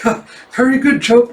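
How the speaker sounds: high, awkward attempt to laugh it off; nervous announcer. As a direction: imitate a flustered host. Announcer covers for master's shock statement.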